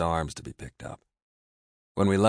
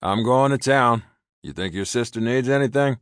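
loud, higher call while leaving; calling over shoulder; 7 semitones higher, 9 dB louder than usual. A narrator's reading. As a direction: call back as he walks away. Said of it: "calling over his shoulder".

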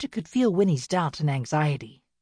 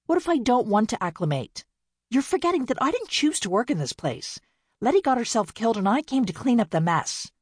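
higher, defiant and bitter; 6 semitones higher than usual. Acted. Winnie defies her sisters, higher and defiant. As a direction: throw it back at her. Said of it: "Winnie raised her head defiantly".

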